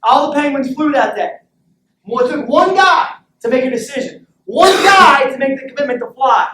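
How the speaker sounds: high, loud motivational climax; impassioned shout. Real, not acted. Coach preaching commitment to his team, impassioned shout. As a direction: drive the moral home, building to a yell. Coach driving home the moral.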